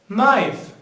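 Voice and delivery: "Knife" as a clear model word, K silent. Shown as a demonstration. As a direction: start "Knife" on N, falling at the end. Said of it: "the letter K here is a silent letter".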